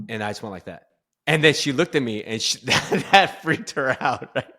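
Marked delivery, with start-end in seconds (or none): 1.3-4.5 loud, high, talking through laughter; laughing. Real, not acted. Teller recalling wife freaked out, laughing as they speak. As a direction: break up laughing mid-sentence.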